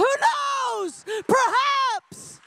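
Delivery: high, ringing preacher's cry; shouted preaching. Real, not acted. Preacher shouting the possibility to the congregation, full force. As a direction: throw each phrase out at the room. Shouted repeat of the hushed musing.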